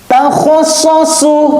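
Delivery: high, drawn-out chanting of the syllables; Quran recitation. Shown as a demonstration. Teacher recites for pupils to copy, high and drawn out. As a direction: chant it like a tajwid teacher showing the sound. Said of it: "Kalau kesulitan dilatih terus".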